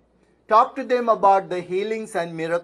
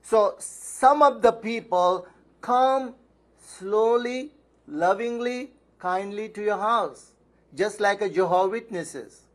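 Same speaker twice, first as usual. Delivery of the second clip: normal talking voice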